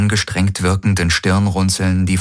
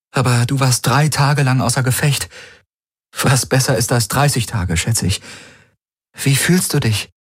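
put-on raised higher, gentle young woman; Kirai's voice; 7 semitones higher than usual. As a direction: speak as Kirai, caring for the wounded.

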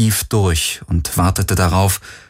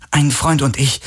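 higher-pitched, mildly irritated reply; annoyed; 6 semitones higher than usual. A narrator's reading. Kirai, annoyed by Galen's questions, answers impatiently. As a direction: brush the question off, a touch annoyed. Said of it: "hörbar genervt von seinen Fragen".

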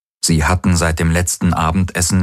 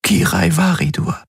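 high, strained full name; emotional; 12 semitones higher than usual. A narrator's reading. Woman reluctantly giving her hated family name, emotional. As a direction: offer the name painfully, high-pitched.

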